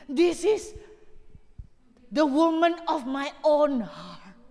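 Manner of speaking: slow, tender voice of God, lightly voiced. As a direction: let God say it slowly and fondly. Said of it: "Tuhan itu ngomong begini". (suara Tuhan yang lembut dan pelan, sedikit diperankan)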